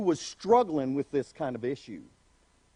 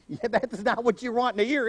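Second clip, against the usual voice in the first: ordinary delivery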